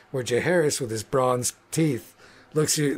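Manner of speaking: ordinary delivery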